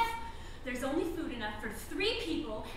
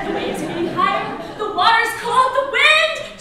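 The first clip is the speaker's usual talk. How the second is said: louder and higher with each danger; building alarm; 5 semitones higher, 8 dB louder than usual. Pretend. Pressing husband as waves rise, alarm building to shouting. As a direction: build the storm to a cry.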